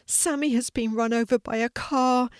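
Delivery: tearful. A narrator's reading. Sidney tells the hedgehog of the accident, mildly tearful. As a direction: break the bad news, mildly tearful. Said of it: "he said as the tears spilled down".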